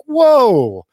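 high, loud, taken-aback exclamation; shocked reaction. Retold or imitated. Reacting to dudes in the corner, shocked. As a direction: act out being stunned, pitch shooting up.

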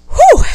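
high, loud whew of relief; loud relieved whew. Real, not acted. Finished venting, a loud high whew of relief. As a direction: blow out the tension.

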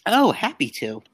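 ordinary talk, nothing marked

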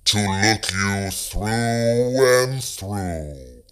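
slow, voiceless creepy whisper; creature whispering. A narrator's reading. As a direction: hiss it slowly like a monster. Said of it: "the creature answers".

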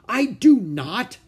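loud, high, slow protest; angry outburst. Acted. Ramona yells at her parents, angry. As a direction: shout it at her parents in outrage.

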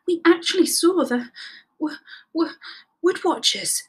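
halting, repeated first sound; stuttering. A narrator's reading. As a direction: stumble on the w in shock. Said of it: "stuttered Rabbit".

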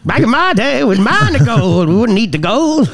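hammed-up old prospector; old-time prospector. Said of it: "That's it".

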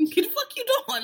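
high, incredulous imitation of a stunned look; Maggie's puzzled face. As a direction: voice a baffled stare in a high squeak. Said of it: "Maggie's face was like".

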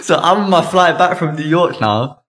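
can barely hold back the laughter; laughing. Real, not acted. Telling a friend the story, laughing.

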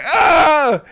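high, loud mock girl's shriek; retold startled shriek. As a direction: squeal like a startled classmate.

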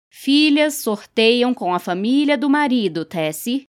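ordinary delivery

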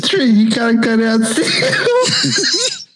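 laughing high and slow. Real, not acted. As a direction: squeal it through giggles. Laughing while telling friend.

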